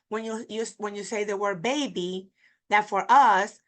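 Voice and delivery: ordinary talk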